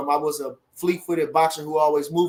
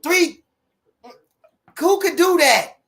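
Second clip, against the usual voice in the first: excited shout; 12 semitones higher, 7 dB louder, slower than usual. Real, not acted. Hyping boxing debate on stream.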